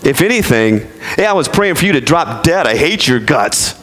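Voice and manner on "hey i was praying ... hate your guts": sarcastic, pitched-up nasty greeting; teasing fake neighborly spite; mock spiteful greeting. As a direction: play a sweet voice saying something hateful. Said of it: "that's how we talk".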